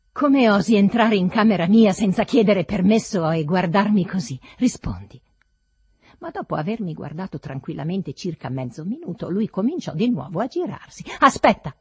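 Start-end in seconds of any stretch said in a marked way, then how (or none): 0.1-5.2 loud, indignant tirade; infuriated. A narrator's reading. Master scolds servant for entering without permission, infuriated. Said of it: "mi infuriava". 11.2-11.8 high, loud urgent shout; yell. A narrator's reading. Master runs after the servant turning away, yell. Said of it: "urlai correndo verso di lui".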